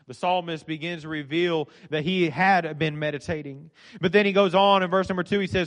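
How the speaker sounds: normal talking voice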